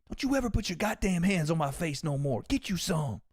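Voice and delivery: a hammed-up threat from Caleb; mock tough threat. Quoting Caleb's threat after slap.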